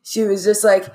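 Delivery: ordinary delivery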